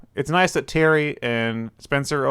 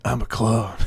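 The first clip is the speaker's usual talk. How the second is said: Shadow the Hedgehog voice. Acted. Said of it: "I'm stuck in my Shadow the Hedgehog voice".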